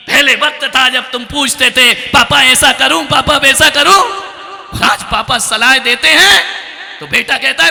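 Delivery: high, loud, building declamation; rising preaching. Real, not acted. Preacher contrasts past and present sons, building loudly. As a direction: build toward the outburst. Builds toward son's outburst.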